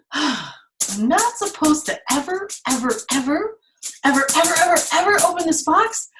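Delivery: put-on a storyteller tempting the children; building temptation. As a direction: climb with every ever. Teacher acting Pandora tempted.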